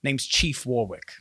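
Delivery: controlled warning with long pauses; stern guard chief. Acted. Guard chief warning private eyes in her district, sternly controlled. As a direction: assert your authority without raising your voice.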